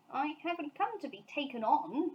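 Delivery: mild indignation; hotly. A narrator's reading. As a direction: protest with a hard stare. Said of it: "exclaimed Paddington hotly".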